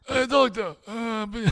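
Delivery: high, halting joke voice; patient's high, stumbling voice; high-pitched patient. Acted. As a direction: put on a high, faltering voice. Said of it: "I've been speaking like this".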